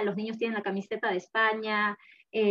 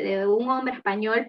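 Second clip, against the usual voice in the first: normal talking voice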